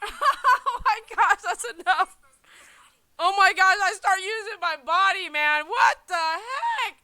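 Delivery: loud, high giggling talk; laughing. Real, not acted. Watching his old video with viewers, laughing loudly. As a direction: laugh through the words.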